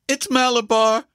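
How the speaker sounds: put-on high, strange boy's cry; strange scream. A narrator's reading. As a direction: scream it as if possessed, pitched very high. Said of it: "screamed in a powerful, strange voice".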